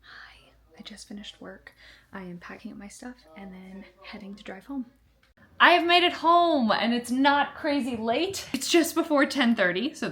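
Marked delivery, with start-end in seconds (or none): 0.0-4.9 hushed whisper to the camera. Real, not acted. Vlogger leaving work, whispering to viewers. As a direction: keep it down to a whisper.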